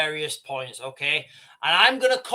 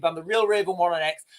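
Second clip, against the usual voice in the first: normal talking voice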